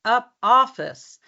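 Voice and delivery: "office" slow and lifted in pitch; stress on OFFICE. Shown as a demonstration. Coach pointing out the stressed word, OFFICE stressed. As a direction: stretch out "office" as the key word. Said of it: "when we stress a word, we go higher".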